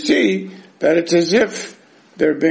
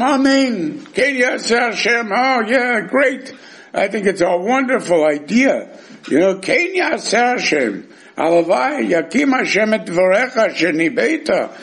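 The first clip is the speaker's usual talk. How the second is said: higher-pitched mock enthusiasm; sarcastic; 5 semitones higher than usual. Yirmiyahu answering a false prophet's promises, sarcastic. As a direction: feign delighted agreement with sarcasm. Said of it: "It's sarcasm".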